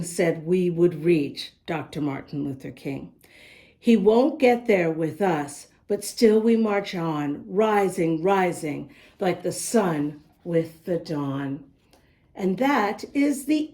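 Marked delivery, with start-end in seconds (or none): none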